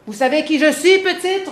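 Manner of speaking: put-on loud, high roar at the girl; roaring knight. Knight roaring at young Jeanne to intimidate her, loud roar. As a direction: bellow it to scare her. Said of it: "rugea-t-il, rugit-il".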